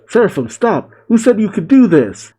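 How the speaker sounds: high, indignant mock shout; shouted command. A narrator's reading. Lilith orders the attacking seraphim to stop, high mock shout. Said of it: "As Lilith shouts".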